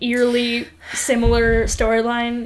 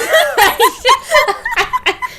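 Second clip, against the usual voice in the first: loud high burst of laughter; high-pitched belly laugh; big laugh; 6 dB louder than usual. Real, not acted. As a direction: crack up helplessly.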